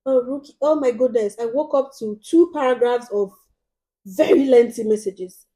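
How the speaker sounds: loud, overwrought plea; imitating a dramatic customer. Vlogger mocking a customer's overwrought plea, loud and dramatic. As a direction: ham up the desperate buyer's complaint. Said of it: "she's like".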